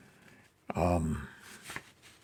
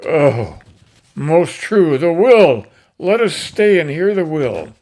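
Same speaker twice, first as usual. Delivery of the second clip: loud and higher, the crowd clamoring; crowd shouting; 7 semitones higher, 12 dB louder than usual. Acted. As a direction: call out eagerly for the will. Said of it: "And the crowd says".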